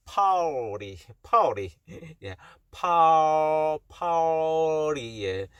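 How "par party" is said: 파티 as party, stretched very slowly with a long curled R; slow-motion American party for 파티; drawn-out R sound. Shown as a demonstration. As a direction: draw out party, T becoming an R. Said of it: "R 발음이 난다는 거".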